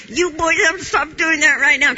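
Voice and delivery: high, loud yell of pure rage; reliving the moment of yelling at the boys; retold furious yell. Mother chasing boys with a broom, furious yell.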